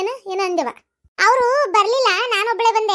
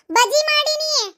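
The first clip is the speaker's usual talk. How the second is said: pitched up and stretched for a deaf grandma; raised, slowed repeat for a hard-of-hearing grandmother; higher, slower repeat; 9 semitones higher, slower than usual. Acted. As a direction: spell it out high and slow so grandma hears.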